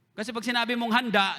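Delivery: ordinary talk, nothing marked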